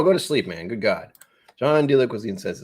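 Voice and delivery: ordinary talk, nothing marked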